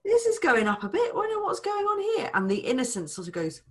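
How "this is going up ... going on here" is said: pitched-up, mock-puzzled concern; lightly voiced fretful partner; worried partner. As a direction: raise the voice in light alarm. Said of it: "they go".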